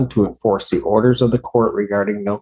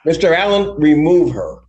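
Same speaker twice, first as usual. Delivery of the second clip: angry; 6 semitones higher than usual. Real, not acted. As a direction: scold her sharply from the bench. Judge ends the hearing angrily.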